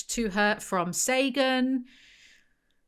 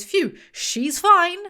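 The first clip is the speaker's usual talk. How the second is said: higher, louder, slow sigh of relief; mock relieved father; 8 semitones higher, 6 dB louder, much slower than usual. The father shrugging off the overdose, drawn-out mock relief. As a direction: ham up the careless relief. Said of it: "The father goes".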